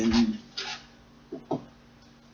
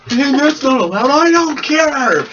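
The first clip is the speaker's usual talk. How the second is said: defiant yell; 12 semitones higher, 6 dB louder than usual. Pretend. Mock defiant nerd rant.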